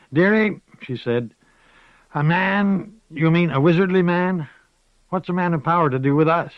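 put-on old witch laughing off wizards; amused old witch. A narrator's reading. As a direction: scoff fondly at the girl. Moss mocks the idea.

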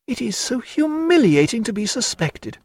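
a hint of a lively lady mocking her paint; Diana. A narrator's reading. Narrator voicing Diana.

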